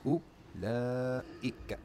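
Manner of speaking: Ulaika stretched out very slowly, syllable by syllable; Sesame Street drawl. Shown as a demonstration. Teacher sounding out the word for students, very slow. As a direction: draw out Ulaika like a kids' show. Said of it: "talking like Sesame Street".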